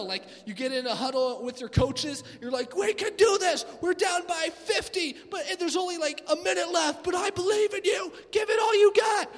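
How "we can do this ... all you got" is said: high, loud, shouted rally call; coach's pep talk. Retold or imitated. Coach shouting to the team in a huddle, high loud rally. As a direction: yell it like a coach down by fifty.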